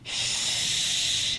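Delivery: strong, loud shh; loud shushing. Shown as a demonstration. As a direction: shush hard like rushing water. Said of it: "I'm talking".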